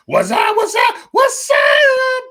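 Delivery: high shouted Martin-style greeting. Retold or imitated. Greeting the stream in his Martin voice, shouting high. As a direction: belt it out like Martin yelling hello. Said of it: "In my Martin voice".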